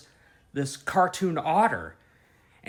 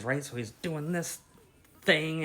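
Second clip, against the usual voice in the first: ordinary talk, nothing marked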